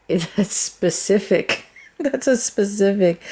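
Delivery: giggling as the words come out; laughing. Real, not acted. Reader amused while finding the word 'specific', laughing. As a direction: talk through laughter.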